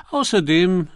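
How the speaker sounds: cheerful. A narrator's reading. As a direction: add it with breezy good cheer. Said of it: "fügte er fröhlich hinzu". (fröhlich und munter)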